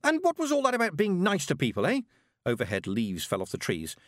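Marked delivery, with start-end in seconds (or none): 0.0-2.0 put-on high-pitched, challenging girl; heated, high young voice; indignant. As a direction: throw the accusation back at her. Girl in shouted quarrel.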